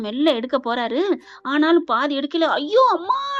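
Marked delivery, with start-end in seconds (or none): 2.6-3.3 high-pitched acted yelp; mock wail, pitch leaping up; cry of pain. A narrator's reading. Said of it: "கத்துது".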